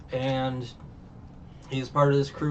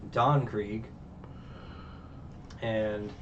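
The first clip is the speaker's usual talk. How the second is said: ordinary talk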